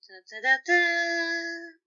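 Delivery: high, slow hummed trumpet call, half-hearted; weak mock trumpet fanfare. A narrator's reading. Virgil teasing Roman with a limp trumpet fanfare. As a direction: toot a feeble triumphant fanfare. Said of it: "weak imitation of triumphant trumpets".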